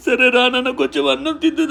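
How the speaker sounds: high-pitched soothing parent voice; soothing, lifted baby-talk tone; tender coaxing. Acted. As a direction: coax a hurt child gently. Father coaxes hurt daughter to eat.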